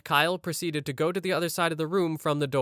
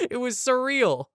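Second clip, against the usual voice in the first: high-pitched, amused laugh into the words; real laughing; 9 semitones higher than usual. Real, not acted. Narrator amused by Kyle's window dive, real laughing. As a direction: say it while cracking up. Narrator cracks up at own story.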